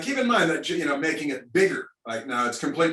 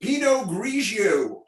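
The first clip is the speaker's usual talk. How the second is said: savoured slowly; 7 semitones higher, much slower than usual. Real, not acted. Host repeats caller's joke name.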